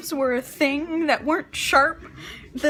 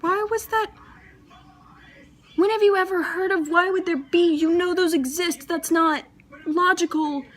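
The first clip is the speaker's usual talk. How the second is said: much higher, incredulous self-scolding; scolding myself; 5 semitones higher than usual. Retold or imitated. Daughter scolding herself for the knife blunder, incredulous. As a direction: tell yourself off in disbelief. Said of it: "I was like".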